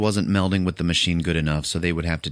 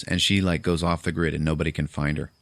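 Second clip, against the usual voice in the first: normal talking voice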